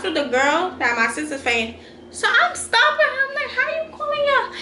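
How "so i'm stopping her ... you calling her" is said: confused. Retold or imitated. Stopping the fight, confused.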